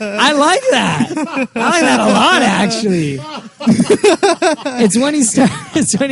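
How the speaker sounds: amused through laughter; laughing along with the story. Real, not acted. Laughing at the Adam West story.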